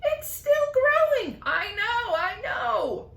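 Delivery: high, loud thrilled exclaiming; excited high cries. Acted. Aunts exclaiming together at the growing peach.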